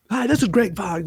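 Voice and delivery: high, bright radio ad read; announcer voice. Retold or imitated. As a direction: sell it like a radio spot. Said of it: "really try to get into it".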